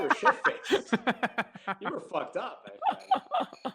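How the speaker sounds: loud laughing ribbing; laughing tease. Real, not acted. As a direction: crack up while teasing him. Teasing friend, laughing.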